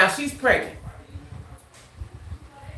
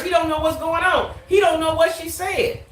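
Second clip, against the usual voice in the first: ordinary talk, nothing marked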